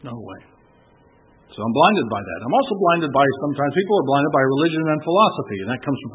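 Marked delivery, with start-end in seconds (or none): 0.0-0.4 very soft, lower disbelieving murmur; hushed refusal. Retold or imitated. Proud people refusing free forgiveness, hushed. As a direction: refuse almost under the breath. Acts refusal, dropped very low.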